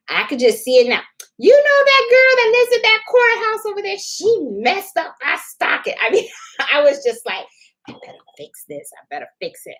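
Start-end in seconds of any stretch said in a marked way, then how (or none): 1.4-5.9 much higher, put-on voice of a gossiping neighbor. 7.9-9.7 hushed, much softer anxious whisper; whispered to herself. Retold or imitated. Whispered worried self-talk.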